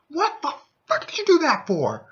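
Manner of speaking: high, exasperated yell at the healer; high-pitched mock yell of disbelief; exasperated outburst. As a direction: shout it in disbelief. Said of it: "the only thing my thief could say".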